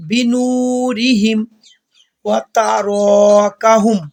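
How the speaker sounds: slow chanted tilawat with held vowels; Quran recitation. Shown as a demonstration. Teacher reciting the corrected verse, slow chant. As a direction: recite the verse with long madd.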